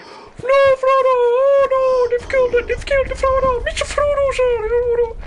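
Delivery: very high, loud squeaky falsetto wailing; mock hobbits wailing. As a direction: shriek like panicked little hobbits. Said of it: "Apparently they've all got high-pitched voices".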